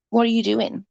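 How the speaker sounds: ordinary delivery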